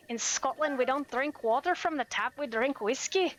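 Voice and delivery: lilting attempted Scottish; Scottish accent. Shown as a demonstration. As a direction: play a Scot with an amateur accent. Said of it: "Can I do a Scottish?".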